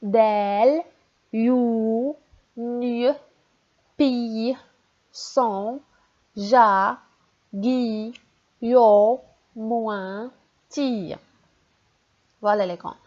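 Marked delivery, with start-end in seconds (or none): 0.0-11.1 syllable reading drill. Shown as a demonstration. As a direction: call out each syllable for the class. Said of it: "On descend la ligne".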